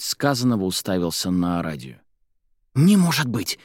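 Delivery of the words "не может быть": high, delighted exclamation; joyful, pitched well up; overjoyed. A narrator's reading. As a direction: burst out in happy disbelief. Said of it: "обрадовался он".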